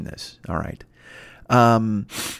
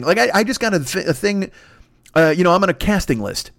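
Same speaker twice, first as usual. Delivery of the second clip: normal talking voice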